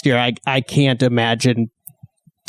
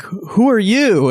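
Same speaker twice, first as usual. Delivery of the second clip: put-on old woman asking; 8 semitones higher, much slower than usual. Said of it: "that old woman that's like".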